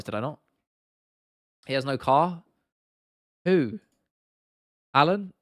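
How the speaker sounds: normal talking voice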